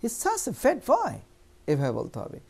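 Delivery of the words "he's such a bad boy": much higher, slow exclaiming tone; exclamation. Shown as a demonstration. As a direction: say it as an amazed exclamation. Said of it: "এখানে exclamation আছে". (অবাক হয়ে বিস্ময়ের সুরে)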